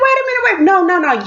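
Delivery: high, hammed-up pleading; panicked protest. Pleading not to be sent back, panicked. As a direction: stop her, in squeaky mock panic. Pleading as officer sends her away.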